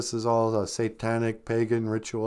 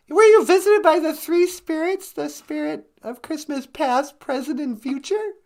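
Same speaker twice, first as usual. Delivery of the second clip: very high put-on questioning voice; high-pitched Natasha character; 4 dB louder than usual. Natasha asking about the three spirits, high put-on voice. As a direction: ask in falsetto, louder at the start.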